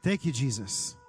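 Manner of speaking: fervent prayer, declaring and thanking. Acted. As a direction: lift it up like a worship leader praying. Prays aloud over the room.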